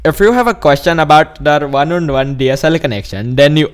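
put-on Indian accent reading; mock Indian-accented phone agent. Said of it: "'Cause I'm doing an Indian accent".

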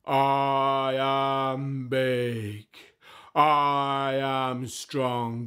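very slow, drawn-out giant statue; booming statue voice. Acted. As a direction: boom each word out like a stone giant. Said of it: "A booming voice said".

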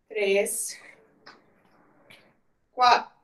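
workout rep counting. Acted. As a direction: coach counting reps aloud. Fitness coach counting reps.

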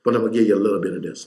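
rattled off fast; tossed off quickly; fast hypothetical aside; mock quote. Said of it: "He didn't say".

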